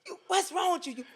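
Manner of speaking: high-pitched mock outrage, breaking into laughter; laughing imitation of an angry scolding; imitated yelling. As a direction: play the furious husband while cracking up. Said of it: "He just sitting there yelling".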